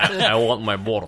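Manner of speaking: put-on laughing through a thick Russian accent. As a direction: quote the villain in broken Russian-accented English. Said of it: "I had some problems with the accent".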